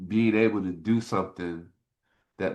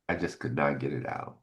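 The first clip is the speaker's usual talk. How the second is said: ordinary delivery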